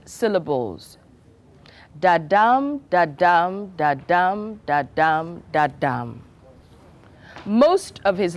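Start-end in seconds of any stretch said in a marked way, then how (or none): 2.0-6.2 da-DUM stressed on the second beat each time, falling in pitch; iambic da-dum beat. Shown as a demonstration. Drama teacher beating out Shakespeare's rhythm for students, stressed second beats. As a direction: tap out the iambic rhythm, weak then strong. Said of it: "the stress pattern".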